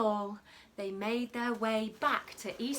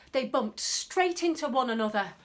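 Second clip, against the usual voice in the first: ordinary delivery, nothing marked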